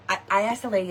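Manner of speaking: ordinary talk, nothing marked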